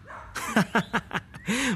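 high burst of laughter; a sudden high laugh. Real, not acted. Laugh before complaint.

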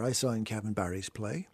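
ordinary talk, nothing marked

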